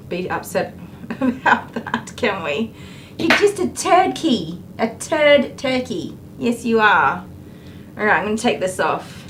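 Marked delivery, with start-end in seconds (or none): 3.2-7.3 high, slow teasing dog-talk; sing-song the tease up high and slow. Real, not acted. Groomer teasing the grumpy dog in high baby talk.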